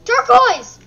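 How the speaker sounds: loud, high shout of the name; sharp, exasperated shout; scolding yell. Real, not acted. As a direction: yell at a naughty pet. Yelling at the pet.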